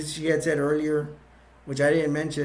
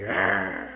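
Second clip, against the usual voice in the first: low, drawn-out zombie groan; zombie growl; 8 semitones lower than usual. Retold or imitated. Zombie surprises the paramedic, imitated low growl. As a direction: imitate a zombie with a deep rattling "argh". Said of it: "there's a zombie like".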